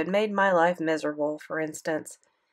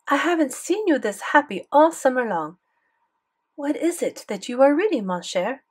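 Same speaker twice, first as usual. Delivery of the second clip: put-on higher, French-accented grandmother; French grandmother's lilting accent; 5 semitones higher than usual. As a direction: gently ask in a French granny voice. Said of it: "thick French accent".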